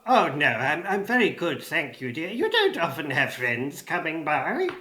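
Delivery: lightly voiced old goblin; a hint of goblin, polite; elderly goblin. Goblin thanks Mona for offering a drink, lightly voiced elderly goblin.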